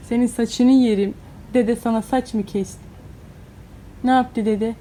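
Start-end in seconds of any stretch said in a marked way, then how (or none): none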